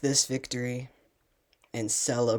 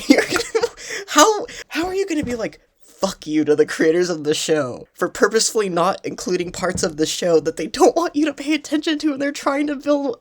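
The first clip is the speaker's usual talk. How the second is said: laughing rant; 9 semitones higher, 5 dB louder, faster than usual. Real, not acted. Ranting, laughing in disbelief.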